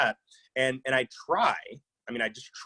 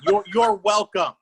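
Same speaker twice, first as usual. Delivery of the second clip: higher, louder, slow, dripping sarcasm; sarcastic mother; 10 semitones higher, 6 dB louder, slower than usual. Retold or imitated. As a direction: drag it out like a mother who has had enough. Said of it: "she sounds like my mama".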